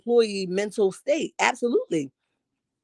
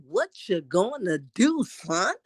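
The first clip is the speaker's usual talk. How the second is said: Miss Marlene; 2 semitones lower, 5 dB quieter, slower than usual. Acted. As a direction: drag it out softly, daring him. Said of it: "Miss Marlene is like".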